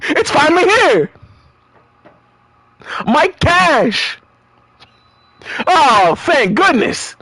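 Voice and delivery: high, elated cries of relief; hammed-up delight; overjoyed cheer. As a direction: cheer like the money finally came. Voicing character thrilled by cash.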